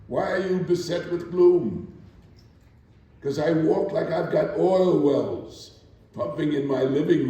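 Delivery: rising, declaiming poetry reading; spoken-word performance of Still I Rise; poem recitation. Acted. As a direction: recite it with building defiance and pride. Recites Maya Angelou poem.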